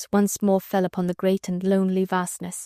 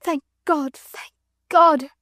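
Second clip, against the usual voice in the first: faint with joy; 7 semitones higher, 4 dB louder, slower than usual. A narrator's reading. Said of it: "faint with the fullness of her joy".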